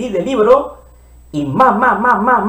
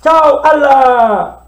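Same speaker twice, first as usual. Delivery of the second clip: playful goodbye; 6 semitones higher, 5 dB louder, much slower than usual. Acted. Saying goodbye to cards.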